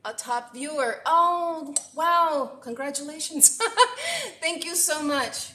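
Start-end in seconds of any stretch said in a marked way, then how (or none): 1.1-4.4 higher and louder, real delight; delighted cheer. Real, not acted. Cheering a new top viewer. 4.4-5.5 higher thanks through a laugh. Real, not acted.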